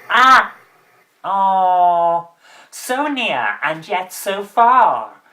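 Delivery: loud, slow theatrical villain; hammy actor voice. Pretend. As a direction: gloat like a washed-up stage villain. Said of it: "This guy is so hammy".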